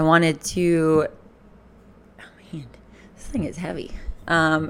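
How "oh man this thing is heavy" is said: whispered aside. Real, not acted. Muttered aside while handling camera.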